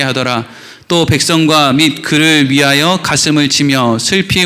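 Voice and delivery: low, even Bible-reading voice; scripture reading. Acted. Reads the scripture passage aloud.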